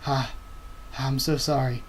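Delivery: ordinary delivery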